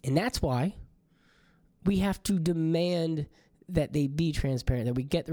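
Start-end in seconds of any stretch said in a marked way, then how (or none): none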